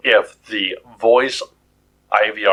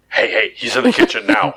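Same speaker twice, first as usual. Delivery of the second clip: tattling appliance; 12 semitones higher than usual. Pretend. Fridge calls spouse.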